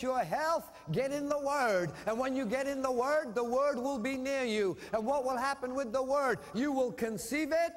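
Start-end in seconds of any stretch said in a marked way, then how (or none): none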